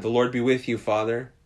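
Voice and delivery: a mild retold call to a father being dragged off. Daughters calling to their father as he is dragged away, calling out. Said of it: "call out to Dad".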